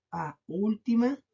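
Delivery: ordinary delivery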